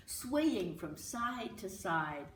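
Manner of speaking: ordinary delivery, nothing marked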